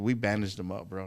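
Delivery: ordinary delivery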